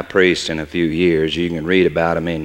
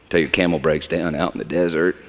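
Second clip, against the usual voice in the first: normal talking voice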